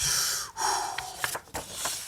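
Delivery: long, quiet blown-out breath; long exhale. Acted. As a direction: let out a slow acted sigh. Acts the character's breath.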